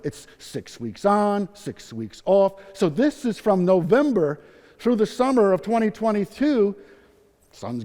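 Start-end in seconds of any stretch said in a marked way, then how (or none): 0.0-2.6 slow and deliberate. Real, not acted. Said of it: "spelling out the schedule".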